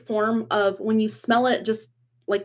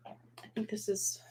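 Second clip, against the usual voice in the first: whispered aside to herself; 16 dB quieter than usual. Real, not acted. To herself while fixing the blurry camera, whispered. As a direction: breathe it, no voice at all, under her breath.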